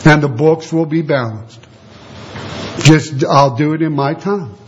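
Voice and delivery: a gentle God reassuring his martyrs. Retold or imitated. Preacher voices God calming martyrs, low and patient. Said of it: "what God told them".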